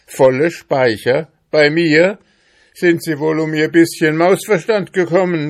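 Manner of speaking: high, loud, indignant tirade; loud, worked-up outburst of protest; angry shouting. A narrator's reading. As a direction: shout in outraged denial. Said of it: "schrie Ambrosius Dauerspeck wütend".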